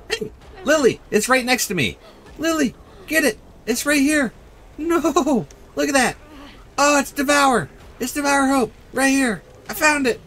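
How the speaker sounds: high, eager urgent voice; excited calling. Real, not acted. Gamer urges Lily to grab the totem, high excited calls. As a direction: call the teammate over excitedly.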